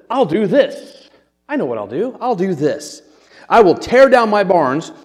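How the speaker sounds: hammed-up bright idea, pitched high; overly dramatic. Preacher acting the rich man's big plan, over-the-top. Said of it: "He's a little overly dramatic".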